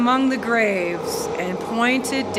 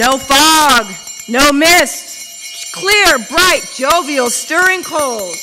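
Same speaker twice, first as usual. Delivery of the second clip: much higher and louder, bursting with delight; jubilant narration; 7 semitones higher, 6 dB louder than usual. A narrator's reading. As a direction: sing out the morning like a child on Christmas. Joyful Christmas morning description.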